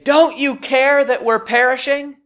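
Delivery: high, urgent accusing plea; acted desperate cry, pitched well up. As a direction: cry out to him as if perishing. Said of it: "look at how they cry out to him".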